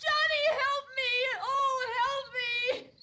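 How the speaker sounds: screaming for help. Retold or imitated. Reliving the attack for the stranger, crying high screams. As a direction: scream for your brother through tears. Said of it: "oh, I screamed".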